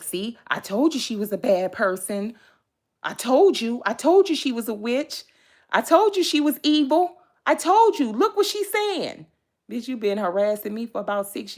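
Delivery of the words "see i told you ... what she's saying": rising, louder, higher gloating accusation; mock tattletale building louder and higher; mocking gossiping accuser. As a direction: parrot the gossipers, building to a high taunt. Mocking the people who gossip.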